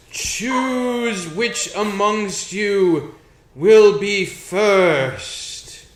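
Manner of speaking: slow, loud, booming threat; menacing ghost voice. Acted. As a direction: loom over them, drawing out each word. Elf statue continues its threat.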